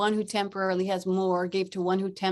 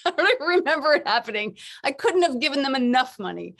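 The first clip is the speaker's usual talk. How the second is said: ordinary talk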